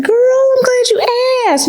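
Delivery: mock-thrilled saleslady; eager exclaim. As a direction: gush that she finally got asked. Consultant's eager answer.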